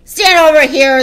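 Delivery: very loud, high-pitched command; barked order. A narrator's reading. Headmistress positions a boy on the platform, shouted order. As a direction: order it at full volume. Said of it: "the Trunchbull ordered".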